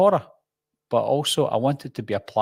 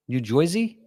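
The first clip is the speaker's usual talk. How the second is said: higher, questioning "New Joisey"; put-on New Jersey twang; New Jersey accent; 6 semitones higher than usual. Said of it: "me doing a New Jersey accent".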